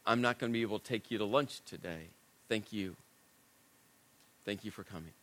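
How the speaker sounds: quiet, shaken, breaking off; barely holding it together, softly; soft trembling voice. Retold or imitated. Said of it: "In this soft, trembling voice".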